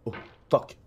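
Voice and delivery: ordinary talk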